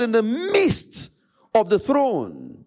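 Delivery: usual speaking voice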